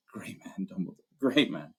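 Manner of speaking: breathy, unvoiced start then faltering; choked-up Hagrid. Hagrid after Dumbledore leaves, choked and soft. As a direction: near tears, barely voicing it.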